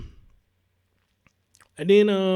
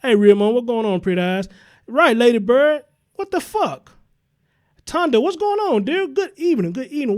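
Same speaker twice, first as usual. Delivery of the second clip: loud, high shout-outs to the chat; hyped greetings; 5 semitones higher, 6 dB louder than usual. Real, not acted. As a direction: call out names like a live host.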